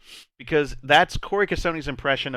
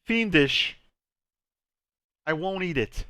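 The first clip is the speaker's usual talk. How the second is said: light Walken-style delivery with long pauses between phrases; Christopher Walken. Shown as a demonstration. Showing a go-to line for getting into Walken, light impression. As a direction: let each short phrase rise, then drop. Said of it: "that'll get you into walking".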